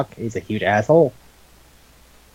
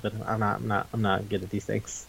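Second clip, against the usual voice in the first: much softer and lower, trailing away; sheepish mumble; 5 semitones lower, 12 dB quieter than usual. Retold or imitated. Vamp pleading he is no builder, sheepish and quiet. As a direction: imitate a meek, apologetic excuse.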